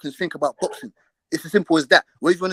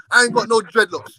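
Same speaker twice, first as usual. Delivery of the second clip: normal talking voice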